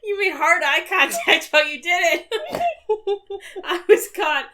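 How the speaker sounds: high, loud helpless laughter; can barely speak for laughing; laughing hard. Real, not acted.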